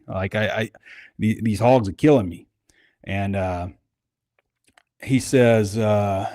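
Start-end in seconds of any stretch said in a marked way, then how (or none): none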